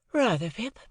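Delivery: put-on cool, airy society girl; Estella. Estella voiced, higher.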